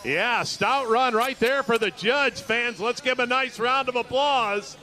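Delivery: rally the crowd after a stout run; excited call. Real, not acted.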